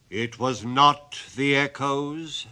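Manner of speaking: booming, slow goblin denial; loud goblin voice. Acted. Goblin booming at the sexton in the churchyard. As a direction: boom it out like a graveyard goblin. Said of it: "said the goblin".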